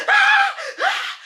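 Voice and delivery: shrill, very high laughing scream of ah; laughing mock scream. Fleeing the devil on the street, laughing mock scream. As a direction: yelp it way up high, half laughing. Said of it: "shouting louder".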